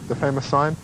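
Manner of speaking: ordinary talk, nothing marked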